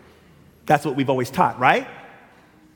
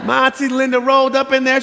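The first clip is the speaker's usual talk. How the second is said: usual speaking voice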